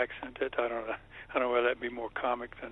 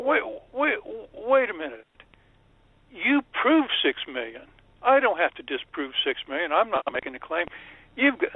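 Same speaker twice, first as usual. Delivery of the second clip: heated retold rebuttal; 7 semitones higher than usual. Retelling his heated rebuttal.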